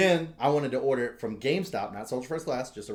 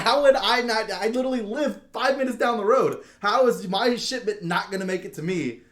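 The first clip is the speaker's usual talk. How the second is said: high and loud, baffled outrage; incredulous rant; 10 semitones higher, 7 dB louder than usual. Retold or imitated. Asking the clerk how shipping could fail, high and loud. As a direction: demand an answer, voice up high.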